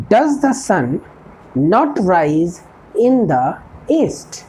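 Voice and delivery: each word spaced out for learners; a teacher dictating an English model sentence, slow and clear; slow dictation. Shown as a demonstration. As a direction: spell it out slowly like a teacher at the board. Teacher slowly dictates model question.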